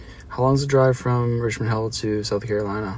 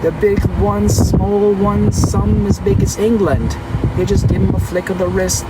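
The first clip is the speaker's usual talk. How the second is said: higher, bouncy mock British singing; British accent; 4 semitones higher than usual. Streamer carrying on the coconut song in a mock British accent. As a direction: lilt it like a music-hall Englishman. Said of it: "That's what the English said".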